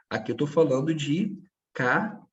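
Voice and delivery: normal talking voice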